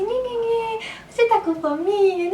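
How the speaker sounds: put-on high cooing baby talk to a baby. Mother cooing baby talk at her baby. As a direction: coo at the baby in a high, sing-song voice. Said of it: "essa conversa de bebezinho".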